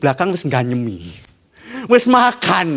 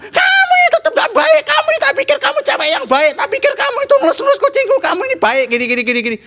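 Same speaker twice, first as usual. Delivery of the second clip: angry ranting; faster than usual. Acted. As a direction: rant at her in a squeaky fury. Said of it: "tetap bilang". (kakek marah-marah ke nenek, suara tinggi dan cepat)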